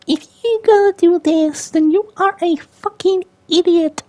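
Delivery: loud, very high mock squeaker voice; squeaky kid. Gamer mocking young voice actors, squeaky kid voice. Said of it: "like, squeaky".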